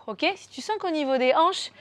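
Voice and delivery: normal talking voice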